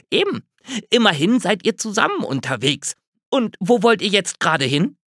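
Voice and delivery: chirpy curious squirrel, lightly done; lightly voiced squirrel. A narrator's reading.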